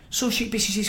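ordinary talk

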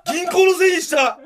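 loud, high accusing yell; mock outrage. Accusing the defendant of blaming the bank, loud high mock outrage.

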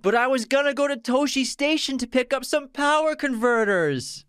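whiny Luke. Acted. Repeating the quote for his co-host, whiny and high. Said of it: "In a very whiny voice".

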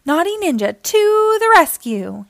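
high, loud rallying cry; heroic shout. A narrator's reading. Naughty Ninja rescuing his dad from the tub, heroic shout. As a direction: call it out like a little superhero. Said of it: "he shouted".

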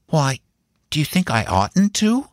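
put-on high-pitched child's voice, parrying; cautious little nephew; guarded young boy. A narrator's reading.

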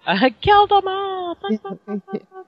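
high frantic freak-out; panicked high voice, fading away. Retold or imitated. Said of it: "Rocco when he was freaking out".

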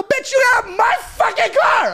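high, slow incredulous yell; mock shout. Driver yelling back at the girls in his car, high mock shout. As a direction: yell it in disbelief. Retold angry retort.

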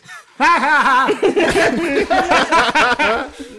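loud, high, smug cackle; laughing to himself. Acted. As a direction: laugh at your own joke. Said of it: "he laughs to himself like this".

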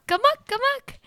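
silly high-pitched repeat; high squeaky amok. Pretend. Repeating the word playfully.

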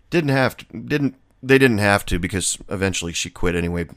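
ordinary delivery

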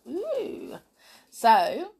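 ordinary delivery

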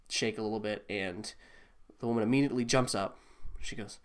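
normal talking voice

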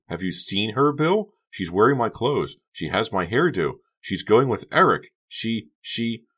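high-pitched, frantic complaint; shrill, upset. A narrator's reading. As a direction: pile up the grievances, getting stuck at the end. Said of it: "she cried shrilly".

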